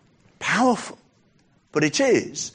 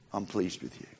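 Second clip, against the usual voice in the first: very soft and low; gentle approval; 7 semitones lower, 13 dB quieter than usual. Acted. God reassuring a doubting believer, very softly. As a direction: drop to a hushed, tender assurance.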